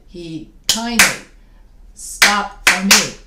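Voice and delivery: slow and loud, hammering "kindly" and "me"; stressed poem beat. Shown as a demonstration. Teacher claps along to Dickinson's line, heavy rhythmic stress. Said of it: "Okay, that one I did".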